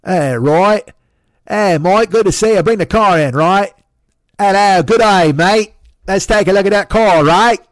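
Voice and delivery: loud, high-pitched Australian accent impression; hammed-up Australian mechanic greeting a customer; Australian Dave. Mechanic Dave greeting him at the shop in a loud, high Australian accent. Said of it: "you know Australian Dave?".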